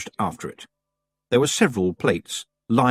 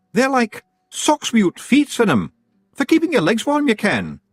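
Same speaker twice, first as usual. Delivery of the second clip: very high Scots pixie voice; tiny Scottish chieftain; Rob Anybody; 13 semitones higher, 5 dB louder than usual. A narrator's reading. Rob explains skuggers.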